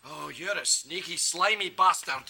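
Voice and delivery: low grudging contempt. Acted. Father realises his son has outmanoeuvred him, low grudging contempt. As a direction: savour each word in menacing disgust.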